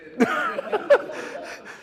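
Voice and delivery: real high giggling laugh; breathless laugh at a teasing joke; unvoiced burst of laughter. Real, not acted. Teasing Zach about water.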